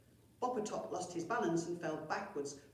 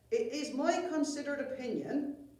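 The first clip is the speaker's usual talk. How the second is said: higher, louder pompous goblin voice; 8 semitones higher, 6 dB louder than usual. Acted. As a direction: sound important and self-satisfied. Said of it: "Snillbog began".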